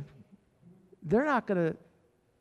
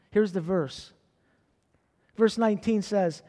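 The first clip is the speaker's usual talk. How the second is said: normal talking voice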